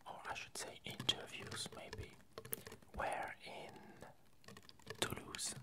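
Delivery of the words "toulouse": normal talking voice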